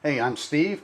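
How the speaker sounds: normal talking voice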